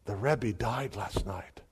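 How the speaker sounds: slow hushed whisper. Retold or imitated. Bookstore clerk telling of the Rebbe's death, slow whisper. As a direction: breathe it out like a secret too heavy to say. Bookstore voice breaks grave news.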